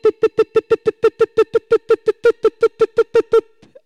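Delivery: high, loud, rapid-fire beeps; imitated tracker alarm on one high note; rapid beeping. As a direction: beep insistently like an alarm going off. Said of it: "going beep".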